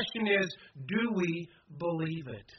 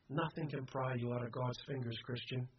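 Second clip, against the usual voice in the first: much softer, lower preaching; quiet assurance; 5 semitones lower, 11 dB quieter than usual. Real, not acted. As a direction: drop right down to reassure.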